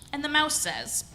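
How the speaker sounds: ordinary delivery, nothing marked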